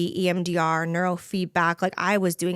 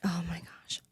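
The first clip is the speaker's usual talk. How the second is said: overwhelmed whisper; 8 dB quieter than usual. Real, not acted. Overwhelmed by the big question.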